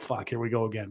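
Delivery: soft, resigned curse under the breath; quiet weary groan of a fed-up fan; muttered to himself. Retold or imitated. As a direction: say it quietly to yourself, dreading it. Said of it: "I'm like".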